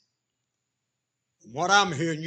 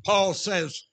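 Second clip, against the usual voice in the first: usual speaking voice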